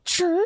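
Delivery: high squeaky "chirr" click sound; imitated screenshot noise. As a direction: chirp like a phone camera shutter. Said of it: "And then I go".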